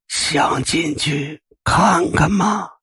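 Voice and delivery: very slow, high, whispery lure; eerie ghost voice. Acted. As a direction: tempt her in, drawn out and creepy. Ghost old man beckoning.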